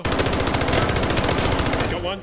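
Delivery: loud, high, drawn-out kill call; excited shout. Real, not acted. Kill during fight.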